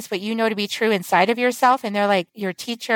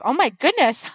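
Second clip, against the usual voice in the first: higher-pitched astonished exclamation; retold shock; 4 semitones higher than usual. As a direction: re-enact being taken aback. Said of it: "like, shocked".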